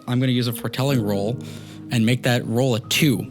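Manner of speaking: usual speaking voice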